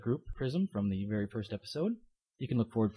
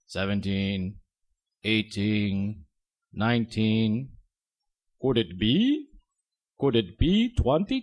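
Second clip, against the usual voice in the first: slow, teasing vampire count; 3 semitones lower, 4 dB louder, much slower than usual. Retold or imitated. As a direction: draw out the suspense. Count teasing number twenty.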